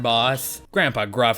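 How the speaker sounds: normal talking voice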